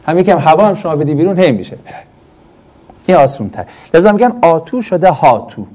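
ordinary delivery

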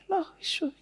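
high thin put-on pious voice; mock fake piety; thin affected voice. Shown as a demonstration. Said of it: "صداش نازک یعنی این".

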